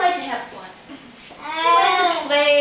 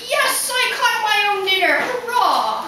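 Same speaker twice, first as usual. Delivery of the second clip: high, loud triumphant cheer; proud tiger; 6 semitones higher, 4 dB louder than usual. Acted. Proud tiger has caught his own dinner, jubilant cheer. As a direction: crow over a first success. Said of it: "the tiger's so proud".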